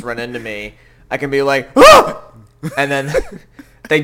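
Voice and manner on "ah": sudden high loud scream; startled scream. Retold or imitated. Startling a coworker with a loud scream. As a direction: shriek as if startled. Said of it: "I make, like, a really loud noise".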